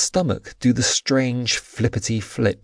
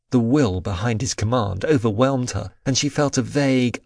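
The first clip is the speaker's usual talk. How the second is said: ordinary delivery, nothing marked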